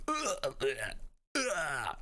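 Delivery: high-pitched mock retching "ugh"; disgusted groan. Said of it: "Because it's gross".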